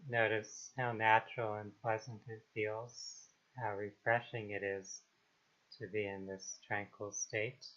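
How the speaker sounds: calm, unhurried meditation voice with long pauses; guided meditation. Acted. Meditation guide helping listeners release trauma and anxiety, calm and unhurried. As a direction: ease them gently into stillness, phrase by phrase.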